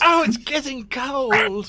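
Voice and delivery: high, loud, drawn-out character wail; hammed-up suffering cry; mock wail. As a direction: wail it slowly in a high put-on voice. Wounded character cries out in combat.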